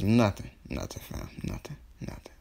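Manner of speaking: trailing down into a whisper; hushed brush-off. Acted. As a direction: mumble it away, fading to near silence. Sasuke brushes off Naruto's question.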